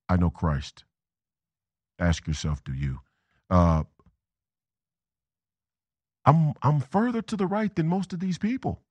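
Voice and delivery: normal talking voice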